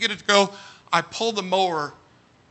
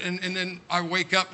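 ordinary talk

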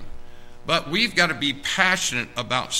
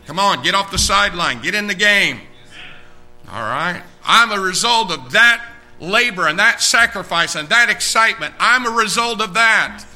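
full-force preaching; 6 semitones higher, 6 dB louder than usual. Real, not acted.

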